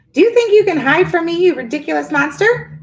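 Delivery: put-on high, loud old witch's bellow. A narrator's reading. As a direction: bellow it across the swamp at the monster. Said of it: "she bellowed at the swamp".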